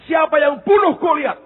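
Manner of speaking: high, loud angry pastor quiz; stern pastor. Retold or imitated. Pastor grilling a frightened student, angry and loud. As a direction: bark the question like an irritated pastor.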